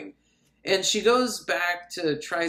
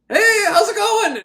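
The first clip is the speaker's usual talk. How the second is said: loud, high-pitched popping-up holler; startling greeting; 8 dB louder than usual. Retold or imitated. Strange man pops up beside her, loud mock-cheery greeting. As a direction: holler a sudden hello way up high.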